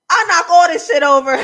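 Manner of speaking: loud, high, hammed-up quote; worked-up shout. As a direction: shout it out in delighted disbelief. Excited reaction, quoting Farrah's outburst.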